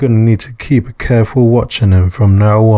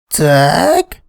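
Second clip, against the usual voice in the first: higher, light female voice, caught off guard; surprised woman; 6 semitones higher, slower than usual. Acted. Bar owner finding Dag in the staff kitchen, surprised higher voice. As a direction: play the woman startled to find him.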